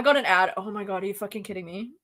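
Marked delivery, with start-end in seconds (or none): none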